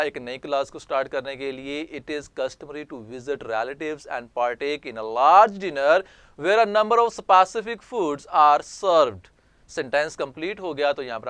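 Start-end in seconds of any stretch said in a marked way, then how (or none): 2.0-9.3 slow, deliberate dictation reading; careful classroom reading voice; slow read-aloud. Shown as a demonstration. Teacher reads example text aloud.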